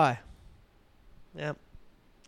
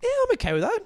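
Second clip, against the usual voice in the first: very high-pitched retold reaction; mock breezy acceptance; faster than usual. Recalling his first reaction to the label, in a high mock-accepting voice. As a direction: say it way up high, like brushing it off. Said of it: "when I first heard it, I went".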